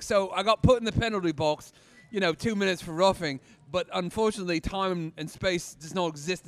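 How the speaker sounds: hammed-up British accent, projected; Cockney accent. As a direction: spin a tall tale in broad Cockney. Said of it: "going into the British accent, like the Cockney".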